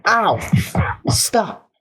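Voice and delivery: high yelp then plea; pained yelp. Acted. Jax hurt by Zubul, yelping high. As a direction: cry out in pain.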